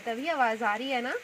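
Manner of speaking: ordinary talk, nothing marked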